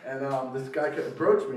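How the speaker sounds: usual speaking voice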